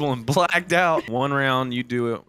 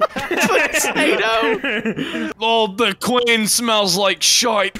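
slow, higher put-on Scottish accent; 5 semitones higher, slower than usual. Friends daring each other in a game, mock Scottish accent. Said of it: "Do your best Scottish accent".

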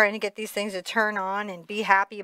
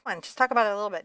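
rushed coaxing; much faster than usual. Pretend. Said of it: "And you're like".

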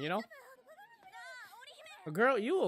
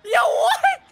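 high, loud laughing disbelief; shocked laughing yell; 7 dB louder than usual. Real, not acted. Shocked laughing reaction to outfit.

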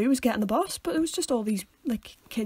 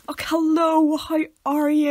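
fake cheery greeting; 6 semitones higher, 7 dB louder, slower than usual. Bluffing greeting, forgotten name.

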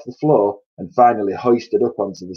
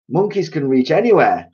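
raised, higher impatient retort; mild shout; 7 semitones higher, 4 dB louder than usual. A narrator's reading. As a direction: call it out over their objections. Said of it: "shouted Mugglewump".

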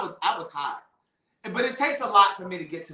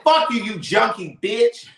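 angry retort; 4 semitones higher, 6 dB louder, slower than usual. Retold or imitated. Retelling cursing out her sister.

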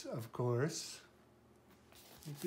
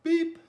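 high, loud friendly toot; truck beep; 12 semitones higher, 10 dB louder than usual. A narrator's reading. Friendly little truck greeting the animals, high beep. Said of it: "said the friendly little blue truck".

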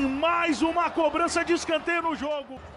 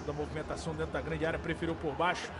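normal talking voice

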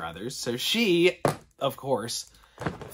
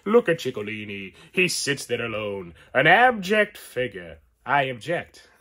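a hint of Chico's Italian accent, louder on the punchline; second Chico accent try; 2 semitones higher, 8 dB louder than usual. Retrying the Chico quote, a touch of Italian accent. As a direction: take another swing at Chico's accent. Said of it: "I can't do Chico".

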